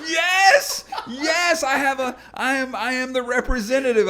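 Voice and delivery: high, loud, laughing and teary; proud laughing shout. Retold or imitated. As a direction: shout it with joyful pride.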